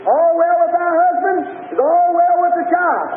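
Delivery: high, drawn-out preacher's call; imitating someone calling from afar; retold holler. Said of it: "So he hollered".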